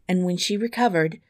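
plain reading voice

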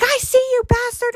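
high, taunting mock call; dad calling out. Daughter mimics dad spotting haunted house actors, calling them out. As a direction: imitate Pop calling out someone hiding. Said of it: "he, like, calls out these".

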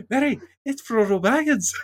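put-on high, loud, kid-like announcement; a little kid telling her dad a thrilling discovery; childlike excitement. Host imitating a child shouting to her dad about Frodo, excited. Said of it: "And then I".

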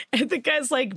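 ordinary talk

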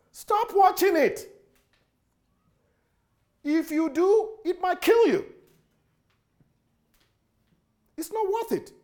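sharp high warning to the flock; high emphatic warning. Acted.

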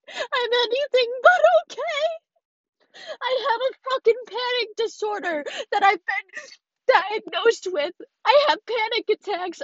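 tearful, high-pitched admission; real crying. Real, not acted. Podcaster admitting to listeners she is not okay, crying. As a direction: let it out through tears. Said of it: "I sound like a fucking dumbass when I'm crying".

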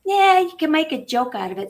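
ordinary talk

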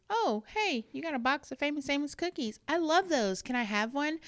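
high, chirpy mock cookie-asker; imitating eager asker. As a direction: pipe up eagerly, angling for a share. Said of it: "When someone says".

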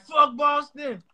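high, drawn-out mock holler; quoted rallying cry. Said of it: "It's like".

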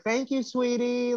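high, slow and sugary thank you; kind sweet reply. Retold or imitated. Heel wrestler answering a drunk fan, high and sweetly teasing. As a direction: kill the heckler with kindness, sweetly. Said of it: "she was so kind".

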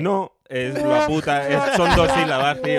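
loud, high, real exasperation; exasperated protest. Real, not acted. Correcting friends on syllable count, loud exasperated protest. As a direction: shout the correction at your friends.

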